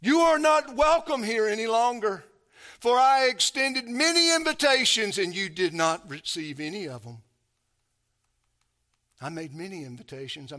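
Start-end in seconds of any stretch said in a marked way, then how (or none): 0.0-7.2 loud, high, impassioned preaching; preaching at full force. Acted. Preacher pressing the eviction on the church, loud and fervent. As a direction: thunder it to the congregation.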